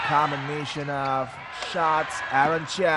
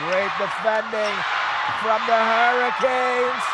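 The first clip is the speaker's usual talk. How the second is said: rising, louder excited call; excited commentary; 6 semitones higher, 5 dB louder than usual. Real, not acted. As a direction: build the excitement as the rally heats up. Climax of a close badminton match.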